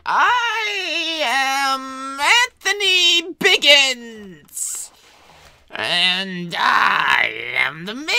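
slow, loud, high theatrical announcement; grand villain intro. Acted. Mad doctor proclaiming himself to the audience, slow and loud. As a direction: boom out your name like a mad mansion owner.

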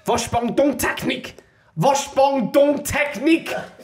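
hammed-up German yell, slow and high; mock German shouting. Mock German shouting at a woman heading for the loo. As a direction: bellow it like a panicking German man.

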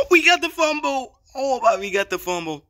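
high, loud burst of real excitement; elated gamer shouting, then easing slightly; thrilled yell. Real, not acted. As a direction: gasp and cry out at the turnover. Celebrating a recovered fumble in game.

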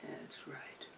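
breathy hushed whisper; barely-there soothing whisper. Real, not acted. Whispering to the possum as she eats.

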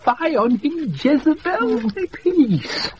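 indignant outburst. Acted. Outraged in hiding.

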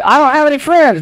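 very high, hammed-up self-pity; exaggerated poor-me voice; mock complaint. Mimics a complaining congregant.

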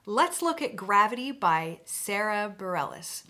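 ordinary talk

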